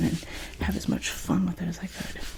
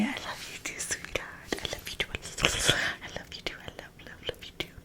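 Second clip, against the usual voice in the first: tender whisper; 5 semitones higher, 9 dB quieter than usual. Real, not acted.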